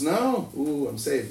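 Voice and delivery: normal talking voice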